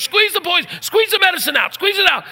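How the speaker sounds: high, urgent mock pleading; retold panic, high and pushed; panicked pleading. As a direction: imitate a frightened kid ordering help. Panicked EMT begging for help.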